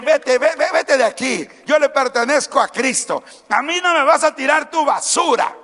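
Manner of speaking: preacher's defiant rebuke to the devil; rebuking the devil. Pretend.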